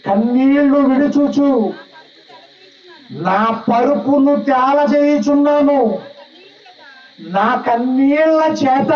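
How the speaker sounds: high, slow, grieving psalm reading; tearful lament. Acted. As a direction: pour out David's weeping slowly. Preacher voices David's weeping psalm. (ఏడుస్తూ నెమ్మదిగా చదివే విలాపం)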